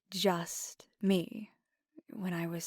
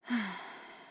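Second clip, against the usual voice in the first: short, heavy exhale; weary sigh. Acted. Explaining absence because of work, weary sigh. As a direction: sigh at the workload.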